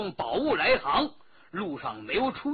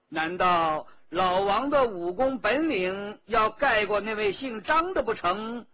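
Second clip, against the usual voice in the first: usual speaking voice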